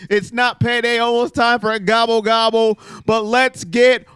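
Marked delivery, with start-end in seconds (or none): none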